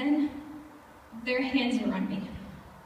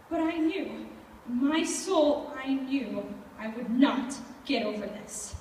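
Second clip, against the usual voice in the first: ordinary talk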